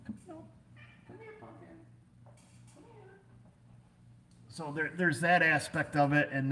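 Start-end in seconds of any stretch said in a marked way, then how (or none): 0.1-0.4 much higher and much softer, sweet coaxing; pet voice. Real, not acted. Calling the cat over in a high cooing pet voice. 1.1-3.2 much higher and much softer, sweet coaxing; pet voice. Real, not acted. Calling the cat over in a high cooing pet voice.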